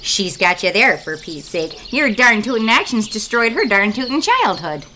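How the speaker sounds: exaggerated Minnesota accent, fretful; family and reporter argue politely, Minnesota accent. Minnesotans squabble on air.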